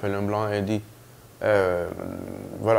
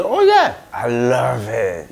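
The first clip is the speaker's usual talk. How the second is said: eager boast; 4 dB louder, slower than usual. Retold or imitated. Patient proudly telling the nurse he loves bushmeat, excited. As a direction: show off happily.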